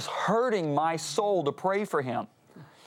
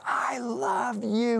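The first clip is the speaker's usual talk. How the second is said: drawn-out, much higher, slow cry of love; heartfelt cry; 9 semitones higher, much slower than usual. Retold or imitated. Crying out love for the man who hurt him, slow and high. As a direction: let the words stretch out high and aching. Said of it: "and I screamed out his name".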